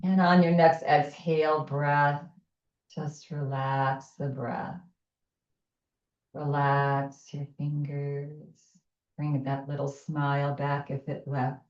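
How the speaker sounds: calm, unhurried breath guidance; guided meditation. Acted. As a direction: ease them through each breath.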